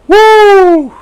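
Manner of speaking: long, high, loud "woo"; overwhelmed whoop. Real, not acted. Preacher trying to hold himself together, overwhelmed whoop. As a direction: release the emotion in one big whoop.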